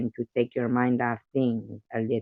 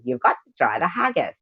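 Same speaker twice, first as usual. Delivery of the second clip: higher-pitched, lilting Scottish accent; 4 semitones higher, 3 dB louder than usual. Shown as a demonstration. Streamer showing the only Scottish line she can do, Scottish accent. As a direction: put on a swooping Scottish accent. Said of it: "And for Scottish, all I can do".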